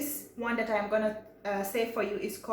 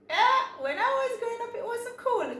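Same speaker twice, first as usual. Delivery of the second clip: high-pitched put-on voice; mock protest; 13 semitones higher than usual. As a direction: protest in an exaggerated, much higher voice. Mock protest at demands to sound cool.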